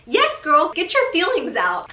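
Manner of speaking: high, loud hype cheer; root for her like a best friend. Retold or imitated. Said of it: "And I was like".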